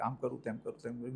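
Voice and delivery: hushed, half-voiced inner thought; dreamy mind wandering, very soft; inner musing. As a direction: mutter it to yourself, almost under the breath. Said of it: "અંદર ધૂન ચાલ્યા કરતી હોય".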